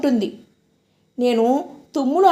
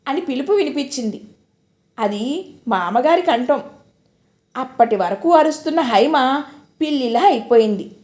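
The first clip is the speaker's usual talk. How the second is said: plain reading voice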